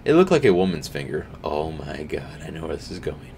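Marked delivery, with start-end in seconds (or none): none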